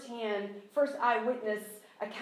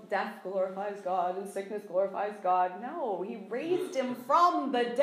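ordinary talk, nothing marked

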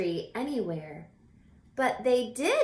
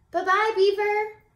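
much higher, louder bright farewell; cheery goodbye; 12 semitones higher, 9 dB louder than usual. Acted. Charles waves goodbye to Beaver, high cheery farewell. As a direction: sing out a happy goodbye.

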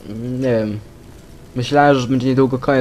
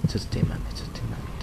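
very soft, whispery self-talk; hushed muttering; 11 dB quieter than usual. Real, not acted. Player searching a room alone, hushed mutter to self. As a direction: mutter it under your breath.

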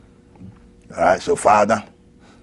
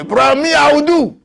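mock defiant boast; 4 dB louder than usual. Mock defiant speaker, laughing.

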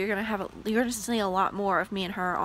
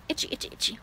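whispered itchy muttering; whispering; 4 semitones higher, 5 dB quieter, much faster than usual. Real, not acted. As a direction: whisper it while scratching. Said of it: "I'm itching".

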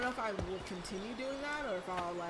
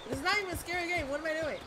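exasperated; 8 semitones higher, 6 dB louder, much faster than usual. Real, not acted. Exasperated at the game.

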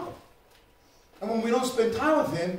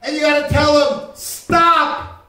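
loud, high, driving sermon shout; preaching at full force; 4 semitones higher, 11 dB louder than usual. Acted. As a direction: preach it out to the whole congregation.

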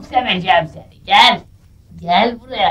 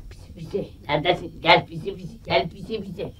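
coaxing cat; 5 semitones lower, 8 dB quieter than usual. Acted.

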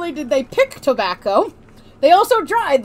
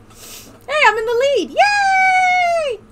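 excited cheer; 4 dB louder, much slower than usual. Real, not acted. Celebrates taking the lead.

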